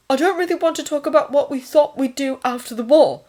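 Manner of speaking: high-pitched, firm cut-in; interrupting. A narrator's reading. Thomas stops the nurse's talk of after-war plans, interrupting. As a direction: cut across and shut the topic down. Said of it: "Thomas interrupted".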